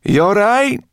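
high, loud, anxious question; anxious call. A narrator's reading. Sorrel checks on the dragon, anxious call. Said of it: "Sorrel asked Firedrake anxiously".

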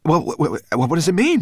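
high-pitched bafflement; baffled. A narrator's reading. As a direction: stammer it out, loud and puzzled.

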